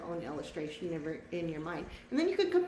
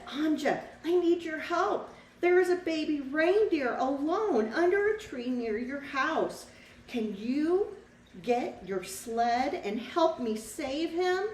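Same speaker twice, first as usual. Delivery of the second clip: higher, a bit louder urgent little bird; cardinal's voice; 6 semitones higher, 5 dB louder, slower than usual. Acted. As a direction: plead for help as the small red bird. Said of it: "The bird said".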